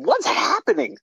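very high-pitched, incredulous question; bewildered mock outcry. Filmmaker recalling confusion over the editing process, bewildered. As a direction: replay your bewilderment in a squeaky high voice.

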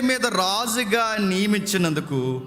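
slow, measured reading of the verse; scripture reading. Acted. Preacher reading the Bible verse to the church, slow and solemn. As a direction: lay out each phrase slowly for the congregation. Reads scripture slowly with pauses.

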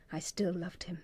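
breathy whisper of love. Acted. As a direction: whisper it like a confession.

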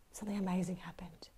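hushed storyteller whisper. A narrator's reading. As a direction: whisper it like a secret. Storyteller builds suspense.

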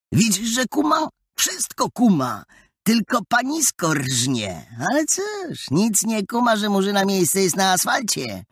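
high, mocking street-boy voice; jeering bully. A narrator's reading. Story bully jeers at girl.

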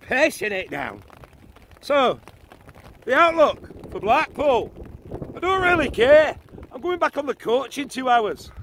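bad Scottish accent weather forecast; mock Scottish weatherman. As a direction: play a grumpy Scottish weatherman. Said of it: "Over to you, Jamie's Rock McSoul".

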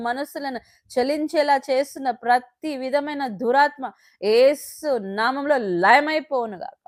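loud, pleading prayer to God; fervent prayer. Real, not acted. A parent praying aloud for the children, loud and fervent.

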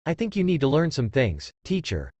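plain reading voice

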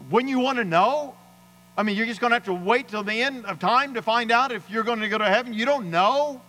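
high-pitched, astonished questioning; incredulous challenge. Retold or imitated. Preacher retelling how he challenged the mechanic about heaven, incredulous.

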